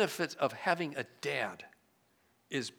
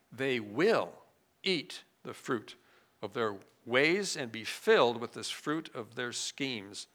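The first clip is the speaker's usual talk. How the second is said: ordinary talk, nothing marked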